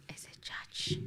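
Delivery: whispered aside; breathy whisper aside. Real, not acted. Quiet aside to co-host.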